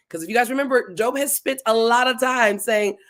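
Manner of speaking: ordinary talk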